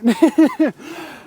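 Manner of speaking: high, loud laugh bursting out; loud burst of laughter. Real, not acted. Laughing at his mate messing up the net, loud high laugh. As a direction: crack up at the botched netting. Friend fumbles netting the fish.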